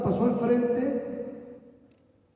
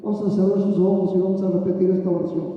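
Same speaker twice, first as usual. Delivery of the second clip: usual speaking voice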